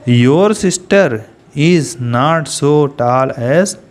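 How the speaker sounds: each word drawn out very slowly for students to write; slow dictation. Shown as a demonstration. Teacher dictating sentence to class. (बहुत धीरे, शब्द-शब्द लिखवाते हुए)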